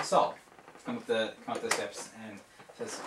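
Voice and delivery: ordinary talk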